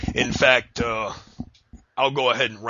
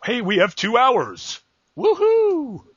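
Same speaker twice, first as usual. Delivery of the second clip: high, loud, stretched-out fake cheer; mock excitement; 8 dB louder, much slower than usual. Host mocks network spin on two hours, fake excitement. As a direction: whoop it up like a hammed-up promo. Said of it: "the best picture possible is".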